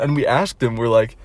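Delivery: ordinary talk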